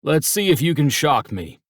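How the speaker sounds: a teasing father's wager; Dad's challenge. Acted. Dad daring his sons to shock him, playful.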